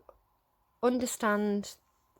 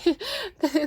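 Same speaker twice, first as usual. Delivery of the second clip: high burst of laughter; 10 semitones higher, 5 dB louder than usual. Real, not acted. Laughs at own beckoning.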